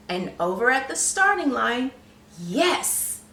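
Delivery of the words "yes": usual speaking voice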